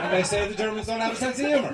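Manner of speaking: normal talking voice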